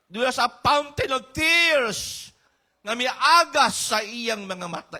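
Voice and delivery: high, loud, fervent preaching; preaching at full force. Acted. Preacher describing Jeremiah's tears to the congregation, at full force. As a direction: drive it home to the church. Preaching about crying prophet.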